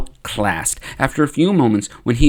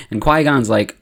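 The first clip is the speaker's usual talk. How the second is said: usual speaking voice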